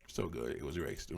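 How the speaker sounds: muttered low and soft under the breath; quiet repeat. Real, not acted. Repeats own line under breath.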